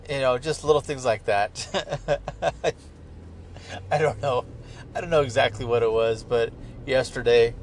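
ordinary delivery

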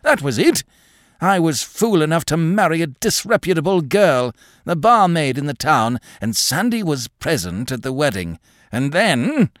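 high-pitched, eager confession; agitated old man. Acted. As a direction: blurt it out excitedly, then trail off.

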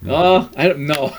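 ordinary delivery

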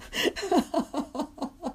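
high-pitched giggling burst of laughter; laughing. Real, not acted. Laughing at own joke about narrative therapy, high giggle.

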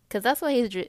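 ordinary talk, nothing marked

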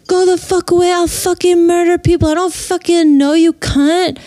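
hammed-up drunken rage at a stranger; drunk furious yelling. Drunk friend curses at the narrator's car window, mock-yelling high.

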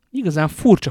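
amused scorn said through a laugh. Acted. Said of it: "folytatta Atosz nevetve".

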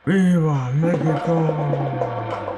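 slow, loud, drawn-out cry; rousing cheer. Acted. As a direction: proclaim it long and proud. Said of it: "one more thing we'd like to say".

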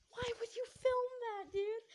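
high, loud, outraged cry; shocked yell. Retold or imitated. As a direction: shriek it at her in disbelief. Shocked friend yelling at Ruth.

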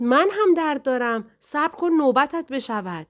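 angry high shout at the pushy mother. Acted. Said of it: "داد زد".

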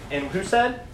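ordinary talk

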